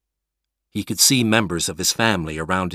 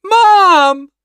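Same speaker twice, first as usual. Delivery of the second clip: much higher, much louder scream for mom; anguished scream; 12 dB louder than usual. Acted. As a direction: cry out into the sky. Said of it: "he screamed".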